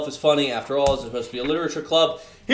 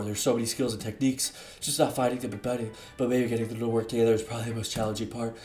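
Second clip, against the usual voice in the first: deep sultry voice; 3 semitones lower, 7 dB quieter, faster than usual. Acted. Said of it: "the deep, sultry voice again".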